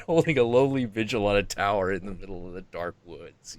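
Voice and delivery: ordinary delivery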